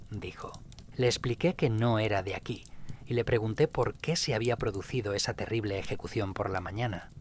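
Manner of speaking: ordinary delivery, nothing marked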